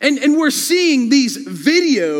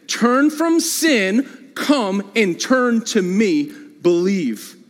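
very slow, weighty call to turn; slower than usual. Shown as a demonstration. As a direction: stretch each word out as a plea. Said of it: "calling for repentance".

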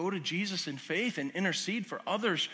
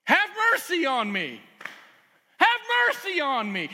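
loud begging plea; 8 semitones higher, 5 dB louder than usual. Acted. Mother begging Jesus, acted.

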